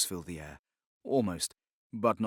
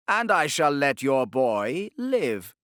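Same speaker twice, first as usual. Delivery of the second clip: slow, higher, louder smooth menace; silky Inquisitor; 5 semitones higher, 7 dB louder, slower than usual. Acted. Torquemada bargaining for the boy, silky menace. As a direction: coax with velvet threat.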